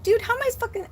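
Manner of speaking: squeaky high exasperation; frantic disbelief. Retold or imitated. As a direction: throw the voice way up in panic. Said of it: "I'm like".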